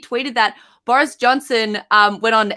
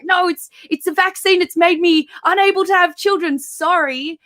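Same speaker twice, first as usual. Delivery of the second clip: higher-pitched, lightly acted excuse-making; mock excuse; 6 semitones higher than usual. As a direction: plead a made-up excuse, ending in an apology. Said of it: "then he was like".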